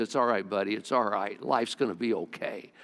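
low, soft imitation of comforting; gently reassuring uncle. Uncle pats a discouraged little boy on the head, gently reassuring. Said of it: "patted me on the head".

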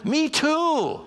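high-pitched heartfelt exclamation; emphatic agreement. Real, not acted. Pastor admits he struggles with mornings too, emphatic agreement. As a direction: burst out agreeing.

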